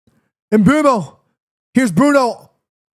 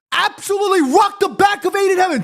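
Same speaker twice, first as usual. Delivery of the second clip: outraged shout; 11 semitones higher than usual. Real, not acted. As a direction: yell it in disbelief at the tackle. Outraged at a missed foul.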